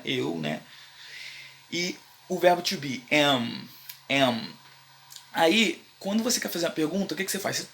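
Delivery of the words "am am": ordinary delivery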